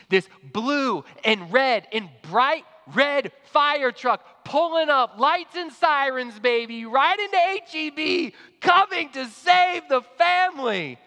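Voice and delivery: preacher exulting over the fire truck's arrival; triumphant excitement. Real, not acted. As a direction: herald the rescue like a victory.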